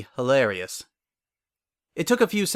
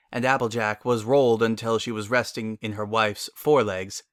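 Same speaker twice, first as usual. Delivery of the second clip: plain reading voice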